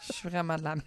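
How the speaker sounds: low, soft, laughing confession; laughing admission. Real, not acted. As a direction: own up sheepishly through a laugh. Owns up, both laughing. (aveu en riant)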